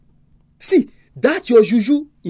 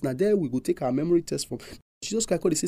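normal talking voice

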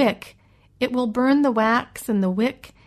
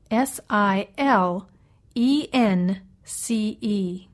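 spelled out; much slower than usual. Shown as a demonstration. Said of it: "spells the word aloud".

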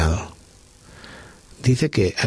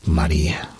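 tender whisper; 5 semitones lower than usual. Acted. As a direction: whisper her name tenderly, like Jesus calling her. Jesús llama a María suavemente. (Jesús susurra su nombre con ternura)

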